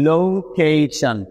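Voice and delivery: Location stretched out very slowly, every syllable drawn long; slow modelled word. Shown as a demonstration. Teacher modelling location for students, very slow and drawn out. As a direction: draw out location slowly so learners hear each part. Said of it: "যদি আমরা এগুলোকে একসাথে বলি".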